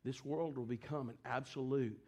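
low, soft, grave sermon delivery; subdued, prayerful preaching; hushed solemn preaching. Real, not acted. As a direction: drop down low and quiet, pleading with the congregation.

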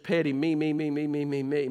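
ordinary delivery

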